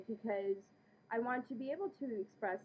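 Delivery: usual speaking voice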